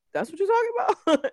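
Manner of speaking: very high, disbelieving question; doctor unimpressed by the tiny bruise, high and incredulous; doctor's incredulous squeak. Retold or imitated. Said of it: "he was like".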